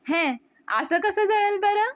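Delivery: high, loud, drawn-out scoff; mock disbelief. Daughter-in-law teasing the angry aunt.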